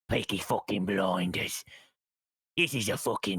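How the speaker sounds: low British gangster accent; Tommy Shelby. Shown as a demonstration. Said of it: "I could do Tommy Shelby from".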